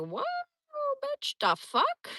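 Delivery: high, slow, drawn-out outraged squeal; shocked outburst. Retold or imitated. Recoiling as he puts her hair in his mouth, shocked squeal. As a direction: recoil in disbelief, voice jumping way up.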